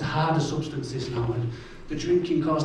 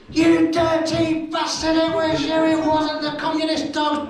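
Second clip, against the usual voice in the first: high, slow sing-song shouting; hammed-up outraged mum; mum's furious yell; 13 semitones higher, 4 dB louder, slower than usual. Mum catching her son out, high mock fury.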